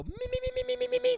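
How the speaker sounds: very high, squeaky repeated me; mock Muppet squeak. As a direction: chirp it like Beaker. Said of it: "then go".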